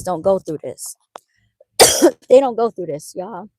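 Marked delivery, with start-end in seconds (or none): 1.8-2.2 a short, loud real cough. Real, not acted. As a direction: cough that breaks into the talk. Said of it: "cough is coming back".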